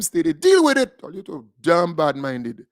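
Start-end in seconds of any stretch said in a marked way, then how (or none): 0.0-1.0 taunting shout. Real, not acted. Taunting critics.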